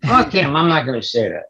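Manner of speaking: tailing off the Pesci wiseguy bit; fading Pesci tough-guy refusal; Joe Pesci impression. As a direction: brush it off like Pesci. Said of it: "That's Joe Pesci".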